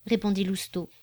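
ordinary delivery

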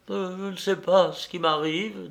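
put-on bewildered, faltering young man; dazed confusion. A narrator's reading. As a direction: speak as if just coming round. Dazed friend coming round.

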